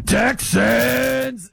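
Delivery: mock arena PA call; stadium announcer call. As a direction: call it out like the PA guy. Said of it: "the guy in the loudspeaker just goes".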